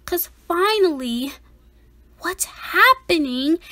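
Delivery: ordinary delivery, nothing marked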